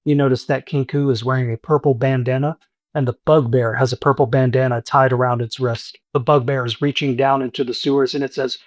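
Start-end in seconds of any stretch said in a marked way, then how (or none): none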